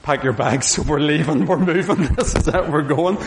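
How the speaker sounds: mock-dramatic quote broken by laughs; half-laughing imitation of an exasperated wife; quoting wife through laughter. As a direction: retell the wife's ultimatum, laughing through it. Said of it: "And then said".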